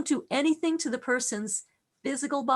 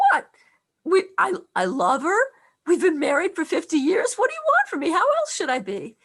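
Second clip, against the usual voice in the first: put-on loud, high-pitched Polish-accented father; 5 semitones higher, 6 dB louder than usual. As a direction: imitate an indignant Polish dad, voice raised. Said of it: "he said in his Polish accent".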